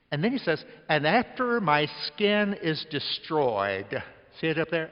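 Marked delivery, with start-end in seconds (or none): 0.9-4.0 slow scripture reading. Acted. Reading scripture aloud slowly.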